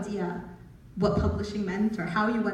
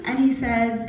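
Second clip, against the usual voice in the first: usual speaking voice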